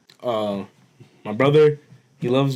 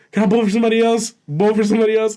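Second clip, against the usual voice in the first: high, fast mock pleading; drunk brother, eager; 7 semitones higher, faster than usual. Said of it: "This man's drunk as shit".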